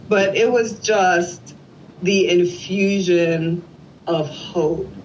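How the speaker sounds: ordinary talk, nothing marked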